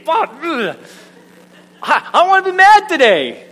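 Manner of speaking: high, loud tantrum wail; comic frustration. Acted. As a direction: whine it loudly like a thwarted child. Frustrated at own cheerful answer.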